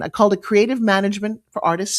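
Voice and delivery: ordinary talk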